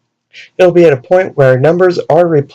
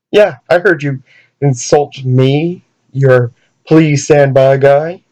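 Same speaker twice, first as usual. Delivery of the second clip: ordinary talk, nothing marked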